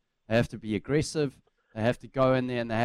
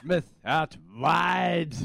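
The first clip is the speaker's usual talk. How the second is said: high, slow, drawn-out commentator call; mock commentary call; 5 semitones higher, much slower than usual. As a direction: stretch it out like live play-by-play.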